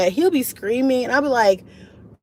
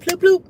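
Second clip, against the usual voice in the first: higher and louder bloop noise; game respawn sound; 10 semitones higher, 7 dB louder than usual. Retold or imitated. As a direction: pop it out like a video game sound. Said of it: "I'll be like".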